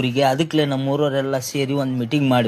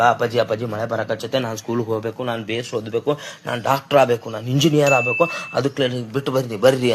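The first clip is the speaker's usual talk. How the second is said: lightly voiced daughter hamming it up to get out of school; overacting child; 3 semitones lower than usual. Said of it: "ಇಷ್ಟೊಂದ್ acting ಮಾಡ್ಬೇಡಮ್ಮ". (ಸ್ವಲ್ಪ ಮೃದುವಾಗಿ ನಾಟಕೀಯವಾಗಿ ಬೇಡುವ ಮಗಳು)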